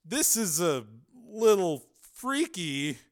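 high, slow, nervous voice; wary and unsettled; uneasy. A narrator's reading. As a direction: hesitate through it, pitch lifted. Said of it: "Higgins said uneasily".

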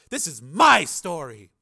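loud, slow possessive outburst; angry scream. A narrator's reading. Reporter defending her story to a rival, angry yell. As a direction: raise pitch and volume, stressing each word. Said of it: "screamed Sheila".

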